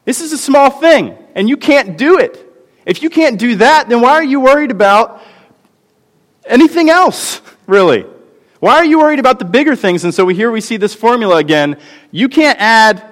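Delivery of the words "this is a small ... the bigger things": much higher, pressing each question hard; a preacher voicing God, pleading and insistent; God's impassioned voice. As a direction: plead as God, urgent and lifted. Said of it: "He's saying".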